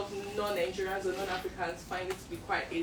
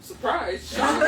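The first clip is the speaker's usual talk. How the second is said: laughing; 6 semitones higher, 13 dB louder, much slower than usual. Real, not acted. Mock surprise at the pronunciation.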